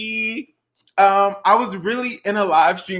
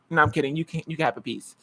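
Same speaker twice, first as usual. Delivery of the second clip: softer, hurried take-back; soft quick take-back; 4 semitones lower, 7 dB quieter, faster than usual. Real, not acted. Teasing the air conditioner, then softly taking it back.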